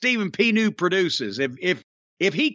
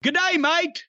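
put-on Australian accent; 9 semitones higher than usual. Greets the Australian listener.

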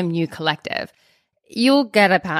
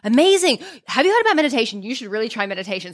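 mock eager pitch; 5 semitones higher, faster than usual. DJ pitching meditation to anxious guest, hammed-up eager. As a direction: sell meditation with hammed-up enthusiasm.

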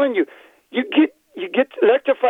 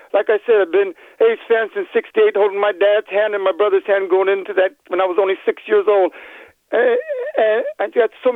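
usual speaking voice

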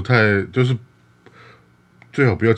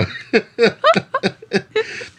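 high falling laugh. Real, not acted. Laughing at own impression.

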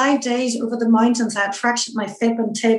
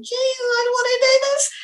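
much higher, tearful plea, half-replayed; retold wee cry. Swimmer lying on her back in the lake, tearfully pleading with herself. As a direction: replay the moment she wanted to quit, near tears. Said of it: "having a wee cry to myself".

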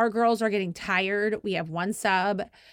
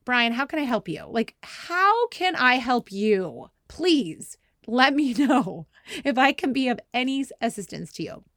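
exasperated sarcasm; 4 semitones higher, 4 dB louder than usual. Retold or imitated. Busy coach to husband bringing crying baby, sarcastic. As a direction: stretch each word with pointed, fake courtesy.

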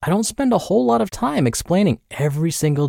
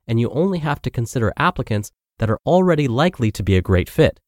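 ad read; 4 semitones lower than usual. Acted. Host reads sponsor ad.

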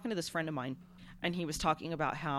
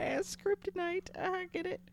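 dumb voice; 14 semitones higher than usual. Pretend. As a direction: play the dimwit. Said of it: "I'm making, like, the dumb face".

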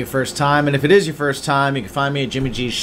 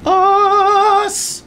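high, loud, held-out "us"; drawn-out shout; 6 dB louder than usual. Pretend. Co-host breaks into the plug with a high, drawn-out shout. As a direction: belt it out high and stretch it.

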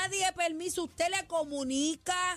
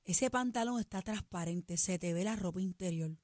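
low, soft, careful "Mi amor"; gentle correction; 8 semitones lower, 4 dB quieter than usual. Shown as a demonstration. Host showing how to tell a partner about see-through pants, soft. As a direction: suggest a change very gently. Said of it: "mira la manera correcta".

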